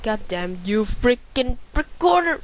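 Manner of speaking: ordinary talk, nothing marked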